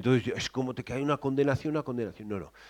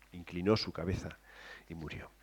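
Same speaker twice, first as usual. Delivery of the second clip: softer telling; 7 semitones lower, 13 dB quieter than usual. Shown as a demonstration. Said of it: "lo suaviza un poquito más".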